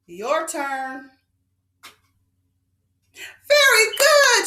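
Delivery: normal talking voice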